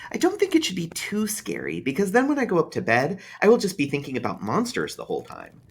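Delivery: put-on a child reasoning it out carefully; young boy bargaining over a story; thoughtful small boy. A narrator's reading. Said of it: "he said thoughtfully".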